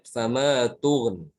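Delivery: sama tughn, long a, closing on n; slow chant, no i after tughn; stopped without ya. Shown as a demonstration. Teacher showing the pause form, chanted.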